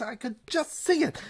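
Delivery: ordinary talk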